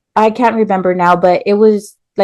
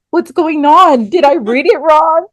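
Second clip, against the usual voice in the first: higher put-on voice, worried and puzzled; confused coworker; 8 semitones higher, 3 dB louder than usual. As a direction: ask as the baffled coworker. Mark confused by her crying.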